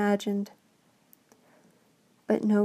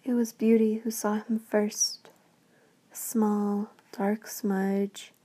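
ordinary delivery

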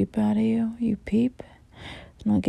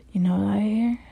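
ordinary talk

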